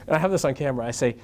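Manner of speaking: ordinary talk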